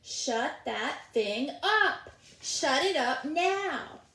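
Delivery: slow, loud furious shouts; angry neighbor yelling. Acted. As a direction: yell over the fence, slow and fierce. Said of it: "unmistakable voice of Missus Tooley".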